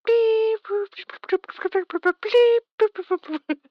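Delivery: very high loud beeping and booping; dial up noise. Shown as a demonstration. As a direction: squeal out the dial up modem. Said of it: "the dial up noise".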